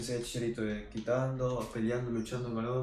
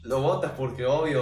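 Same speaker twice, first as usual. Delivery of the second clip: normal talking voice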